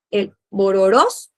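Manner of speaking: bororós with the stress on the final ó, drawn out; teacher modelling stress: bororós with the final ó stressed; stress on last syllable. Shown as a demonstration. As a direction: lean on the last O of bororós, slowly. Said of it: "porque va como que la entonación en la O".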